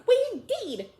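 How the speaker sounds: very high, loud squeak; indignant mouse cry. Acted. Mouse crying out at Alice, high and indignant. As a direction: squeal with trembling outrage. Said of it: "cried the mouse".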